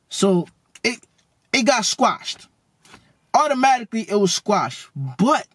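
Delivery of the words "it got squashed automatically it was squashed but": ordinary talk, nothing marked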